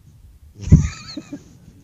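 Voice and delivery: sudden loud high burst of laughter. Real, not acted. Teacher bursts out laughing at the class, loud laugh.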